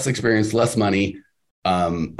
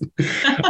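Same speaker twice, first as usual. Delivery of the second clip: high laugh. Real, not acted. Laughing at his own joke.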